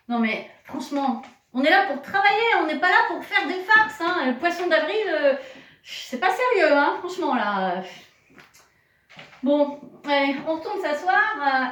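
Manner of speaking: loud, high, fast pretend telling-off; mock scolding. Teacher mock-scolding children about the April fool. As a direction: playfully scold the prank.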